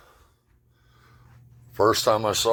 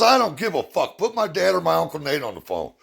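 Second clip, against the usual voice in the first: rude daughter; 8 semitones higher than usual. Retold or imitated. Daughter voiced, rude phone answer.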